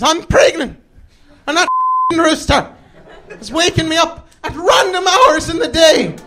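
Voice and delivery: high, irate pregnant-neighbour impression; angry Irish lady. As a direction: scold in a high mock-Irish voice.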